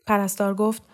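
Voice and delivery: plain reading voice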